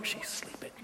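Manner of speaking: whispered aside in disbelief; hushed, as if beside a sleeper. Retold or imitated. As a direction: drop to a near-silent whisper. Amazed aside about sleeping wife.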